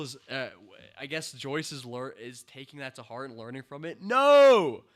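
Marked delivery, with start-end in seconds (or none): 4.0-4.8 a high, loud shout of no; outraged shout; loud protest. Real, not acted. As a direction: yell it in real outrage. Protesting the show ruining Joyce.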